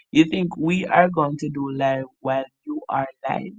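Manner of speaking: ordinary delivery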